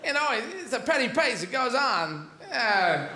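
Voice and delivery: a hint of Walken's broken rhythm; halting Walken cadence, lightly put on; lightly voiced Christopher Walken monologue; bad Walken impression. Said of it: "This is Christopher Walken".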